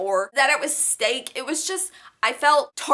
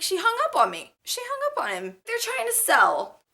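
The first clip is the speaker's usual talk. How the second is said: put-on much higher squeaky boyfriend voice; mock boyfriend, high; 11 semitones higher than usual. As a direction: play the boyfriend in a high whine. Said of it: "He's like".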